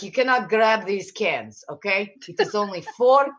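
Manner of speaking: put-on Eastern Bloc accent; Slavic accent. Aldi employee to a shopper grabbing cans, in Slavic accent. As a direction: imitate a Slavic store employee laying down the rule. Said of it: "Slavic accent, you know, Eastern Bloc accent".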